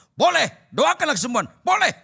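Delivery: loud, high emphatic shout; berteriak. Retold or imitated. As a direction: shout the yes at the top of your voice. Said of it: "Langsung saya teriak". (teriakan lantang bernada tinggi)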